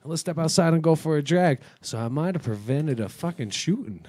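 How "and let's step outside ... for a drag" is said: loud, higher urgent "no, no"; alarmed shouting. Retold or imitated. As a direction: stop a man pulling a gun. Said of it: "And I'm like".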